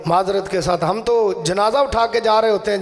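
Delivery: usual speaking voice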